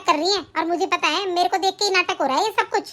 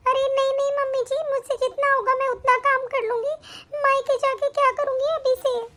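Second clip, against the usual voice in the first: put-on higher, gentle pleading voice of a young wife; meek daughter-in-law; 6 semitones higher than usual.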